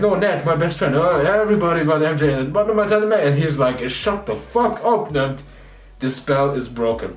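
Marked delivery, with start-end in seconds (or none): 0.1-3.2 higher-pitched, hurried retelling of frantic pleas; lightly acted, urgent butting in; mock frantic interrupting. Retold frantic interruptions of a spell. 3.9-5.0 higher-pitched retold irritation; lightly acted, fed-up cutting off; mock exasperated outburst. Fed-up Strange cuts Peter off.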